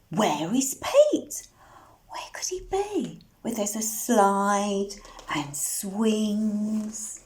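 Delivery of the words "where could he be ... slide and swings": quick whispered question; a fast secret question. Acted. Reader whispers a guessing question to children, quick whisper.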